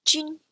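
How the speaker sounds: jun said high with a French un vowel; Mandarin jun. Shown as a demonstration. Teacher models jun for the umlaut U N. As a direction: give jun a high, level pitch. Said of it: "another example".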